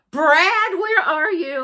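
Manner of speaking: loud laughing call to Brad; loud playful call with a laugh. Acted. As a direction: call him out loud, teasing. Calling out to Brad.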